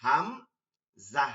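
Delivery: "Hamza" split into a long, loud "হাম", pause, "জা"; very slow, loud two-part "Hamza"; drawn-out syllables. Shown as a demonstration. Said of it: "এইটা নামের ক্ষেত্রে". (টেনে, থেমে জোরে "হাম... জা")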